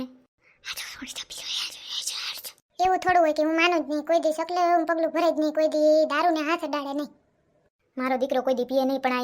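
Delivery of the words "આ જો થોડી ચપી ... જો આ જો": hushed whisper, voice gone. Acted. Mother whispering to her daughter-in-law about her son. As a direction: lean in and whisper the secret.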